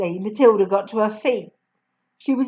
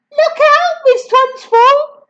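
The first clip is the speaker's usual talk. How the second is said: put-on much higher, louder child cry; cried out; 7 dB louder, slower than usual. Lavender crying out to the headmistress, high and loud. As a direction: yell a mischievous warning. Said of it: "cried Lavender".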